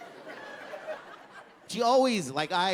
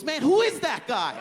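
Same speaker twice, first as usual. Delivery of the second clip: higher-pitched mock outburst; buddy snapping; 7 semitones higher, 3 dB louder than usual. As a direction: snap in disbelief, imitating your friend. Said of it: "My buddy snaps".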